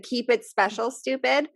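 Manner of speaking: ordinary talk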